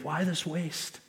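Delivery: quietly scoffing disciples; muttered indignation. Retold or imitated. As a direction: mutter it under your breath with disdain. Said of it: "they were indignant, saying".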